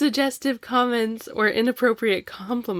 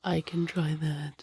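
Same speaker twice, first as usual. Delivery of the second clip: whisper; 3 semitones lower, 8 dB quieter than usual. Pretend. Echoing his robotic attempt.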